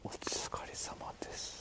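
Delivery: breathy unvoiced whisper. Shown as a demonstration. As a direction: whisper the greeting under your breath. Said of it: "って言っちゃいけないんだっけ".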